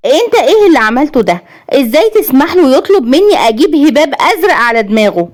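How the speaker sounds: high-pitched indignant outburst; high, heated reproach; outraged wife. A narrator's reading. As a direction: confront your husband in a high, agitated voice. Wife quarrels with husband.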